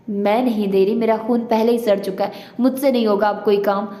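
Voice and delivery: ordinary delivery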